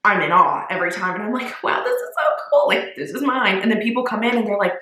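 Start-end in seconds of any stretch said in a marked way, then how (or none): 1.6-3.6 awed. Retold or imitated. Owner in awe of her store.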